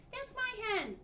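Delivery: very high, loud protest; crying out. A narrator's reading. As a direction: cry it out to the fisherman. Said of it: "she cried".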